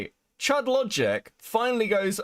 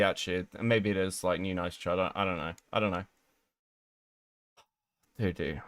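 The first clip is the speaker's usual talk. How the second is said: lower, softer, trailing off; baffled mumble; 12 semitones lower, 5 dB quieter, faster than usual. Real, not acted. Streamer confused by the drama, low soft mumble. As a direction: mutter it to yourself, lost.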